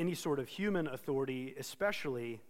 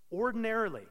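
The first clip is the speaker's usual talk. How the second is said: "ordinarily" set apart, higher and louder; emphasis on ordinarily; 7 semitones higher, 6 dB louder, faster than usual. Real, not acted. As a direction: lean hard on the word "ordinarily". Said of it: "I want to emphasize the word ordinarily".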